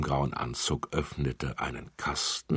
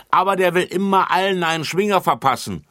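high, loud and fast; quick call; 14 semitones higher, 9 dB louder, faster than usual. A narrator's reading. Bärbel quickly calls out about Lutz, high and fast. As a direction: rush it out to tattle. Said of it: "rief Bärbel schnell".

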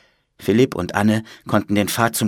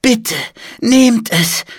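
put-on high, pleading old man; insistent plea; 13 semitones higher, 4 dB louder, much slower than usual. A narrator's reading. Old monk insists children take his book, pleading. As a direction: beg them in a raised, high voice. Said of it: "beharrte Bruder Michael".

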